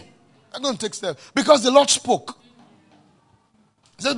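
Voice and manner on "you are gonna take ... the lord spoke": loud, high preaching cry; forceful preaching. Acted. Pastor preaching hard to his congregation, loud and high. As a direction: preach it out with fire.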